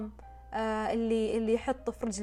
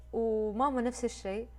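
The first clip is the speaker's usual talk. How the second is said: ordinary delivery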